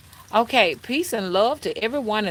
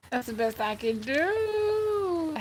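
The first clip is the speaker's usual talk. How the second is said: sing-song resigned; 10 semitones higher, 3 dB quieter, slower than usual. Shown as a demonstration.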